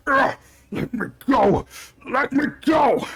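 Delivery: high strained cry to be let go; high straining captive shouting to be freed; struggling captive. Acted. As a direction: fight the grip while shouting. Captive struggling to break free.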